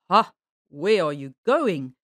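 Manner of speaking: slow, low voice from the stump; low, drawn-out challenge; hidden bear. A narrator's reading. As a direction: call out slowly and low, sizing up the traveler. Mystery voice from the stump.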